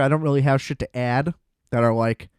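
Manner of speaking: usual speaking voice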